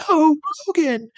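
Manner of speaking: very high hammed-up scream. Jean sees Logan shot and screams, very high. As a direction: shriek his name in mock panic. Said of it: "screamed Jean".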